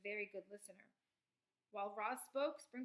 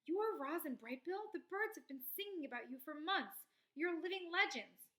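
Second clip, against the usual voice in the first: higher, louder, thrilled rush of words; squeaking with excitement; 6 semitones higher, 5 dB louder than usual. A narrator's reading. Skunk meeting famous visitors, excited squeak. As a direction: gush like meeting a hero. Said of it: "the voice squeaked with excitement".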